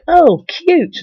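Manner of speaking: squeaky high mock-girly "Oh, cute"; sarcastic girly squeal; mock cutesy gush. Mocking her comment back.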